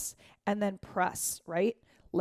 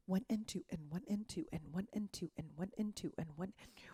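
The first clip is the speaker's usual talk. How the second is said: soft counting; 11 dB quieter, faster than usual. Shown as a demonstration. Dance teacher counting the shimmy for students, softly. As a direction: count the beats softly, almost under the breath.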